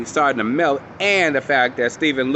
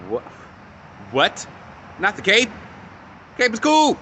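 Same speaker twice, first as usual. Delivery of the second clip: rising, much higher mock outrage; shocked disbelief; 9 semitones higher than usual. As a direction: retell your own shocked reaction. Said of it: "I was like".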